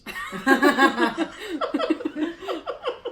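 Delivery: long, loud laughing fit. Real, not acted. Laughing at a friend's no thanks, long loud giggles. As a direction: crack up loudly.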